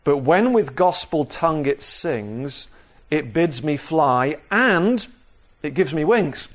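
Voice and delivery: normal talking voice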